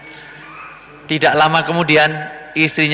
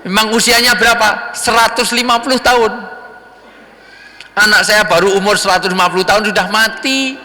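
voiced dialogue; 7 semitones higher than usual. The preacher acting the liar and the old mother, voiced high. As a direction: act out both figures of the exchange.

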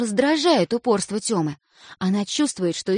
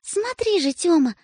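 high, warning stern tone; 8 semitones higher than usual. A narrator's reading. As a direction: wag a verbal finger. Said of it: "уже строгим голосом".

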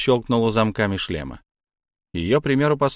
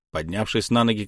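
ordinary delivery

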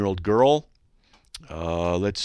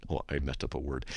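ordinary talk

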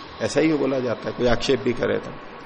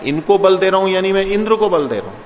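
higher and louder, pushing strength into the words; forceful emphasis; 5 semitones higher, 5 dB louder than usual. Shown as a demonstration. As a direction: say it as if lending Indra strength. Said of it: "स्वरों में बल लगाता है". (ज़ोर लगाकर, ऊँची और बुलंद आवाज़ में)

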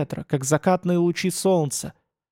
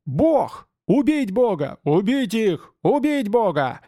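high furious mob yells; angry crowd shouting; 6 semitones higher, slower than usual. Acted. Crowd shouts to kill god.

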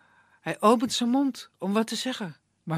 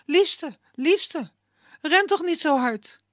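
calling out; 8 semitones higher, 5 dB louder, slower than usual. A narrator's reading.

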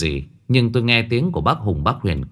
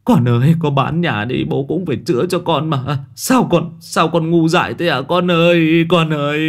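higher, loud, tearful lament; whimpering wail; 7 semitones higher, 3 dB louder than usual. Acted. Father lamenting over his daughter who jumped, loud tearful wail. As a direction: plead through tears to the child. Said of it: "bác Hùng mếu máo".

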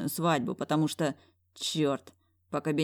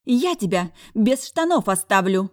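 loud high barking threat; 5 semitones higher, 8 dB louder than usual. A narrator's reading. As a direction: bark it at him, loud. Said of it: "рявкнула силиконовая долина".

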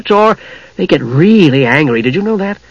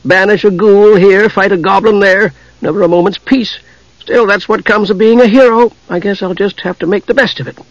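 higher, put-upon kender grumble; sighing irritably; 6 semitones higher than usual. A narrator's reading. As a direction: complain like a much-needed hero. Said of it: "sighed somewhat irritably".

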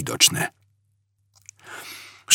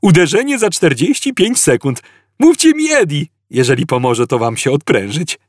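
chirpy computer; 6 semitones higher than usual. A narrator's reading. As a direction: announce doom with breezy friendliness. Chirpy computer announces imminent impact.

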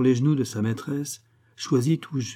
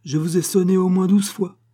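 high, loud scolding; irritated; 9 semitones higher, 8 dB louder than usual. A narrator's reading. Wife to the servant who came late, irritated. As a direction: scold sharply, voice pitched well up. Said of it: "dit la dame irritée".

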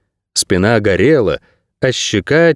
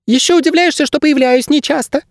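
very high, huffy bird voice; offended squeak. A narrator's reading. Bird guide offended by his ward, high squeak. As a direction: squeak it like a hurt little bird. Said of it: "обиженно пропищал проводник".

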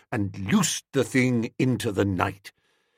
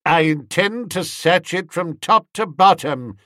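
stern Bassett; 5 semitones higher, 4 dB louder than usual. A narrator's reading. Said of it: "said old Bassett".

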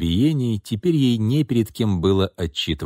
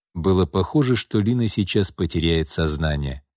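usual speaking voice

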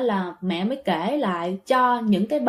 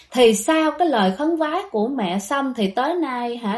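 ordinary delivery, nothing marked

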